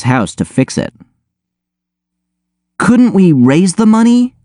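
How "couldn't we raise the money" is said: high-pitched, hopeful plea; pleading. A narrator's reading. Chloe begging Oz to save the competition entry, pleading. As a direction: beg in a much higher pitch. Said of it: "Chloe pleaded".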